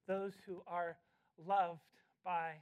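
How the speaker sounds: tender hushed emphasis. Acted. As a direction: let it sink in quietly. Slow hushed stress on loved.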